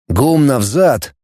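ordinary delivery, nothing marked

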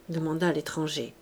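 plain reading voice

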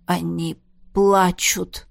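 struggling back into human words, said slowly; slow, halting speech. A narrator's reading. Jaf, just changed back from a wolf, tells everyone slowly. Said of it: "медленно проговорил Джаф".

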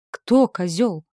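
loud, dumbfounded demon voice; stunned demon. A narrator's reading. Demon asks, stunned, who the goat is, loud and dumbfounded. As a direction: ask it like a stunned brute. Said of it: "ошалело спросил уродливый демон".

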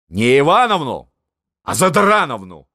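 loud, high jeering retort; husband sneering at the empress's name; mocking correction. A narrator's reading. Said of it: "поправил жену фельдмаршал". (насмешливо, громко)

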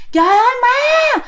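high-pitched mock scream; re-enacted panicked wail, pitched high; terrified cry for help. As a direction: yell for parents to save you. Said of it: "bạn la vậy nè".

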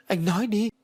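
high-pitched pleading demand; very urgent. A narrator's reading. Ái begging Phú to explain, very urgent. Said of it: "Ái càng nói càng gấp gáp".

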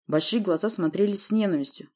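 ordinary talk

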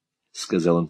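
ordinary delivery

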